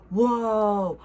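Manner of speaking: loud, amazed whoa. Real, not acted. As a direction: blurt out in awe. Amazed at signed items.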